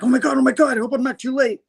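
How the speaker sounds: high, frantic panicked voice; a nerd running for his life, gasping out hope. Retold or imitated. Retelling a comic, nerd fleeing Rhino in high panic.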